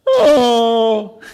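high, loud gleeful Oh; delighted gasp. Acted. Child tearing open a Christmas present, squealing Oh.

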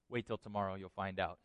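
low, soft voice of warning; ominous hushed teaser. Acted. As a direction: drop it low and quiet, withholding the answer. God teases coming plague, dropped voice.

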